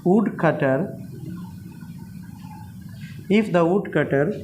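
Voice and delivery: tongue twister broken into parts, long gaps; slow woodcutter tongue twister; very slow dictation. Shown as a demonstration. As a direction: spell it out phrase by phrase. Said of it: "number four".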